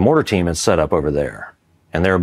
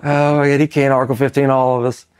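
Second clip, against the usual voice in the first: high-pitched voice of someone losing it laughing; imitated laughing fit; 8 semitones higher than usual. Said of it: "We're just laughing our ass off".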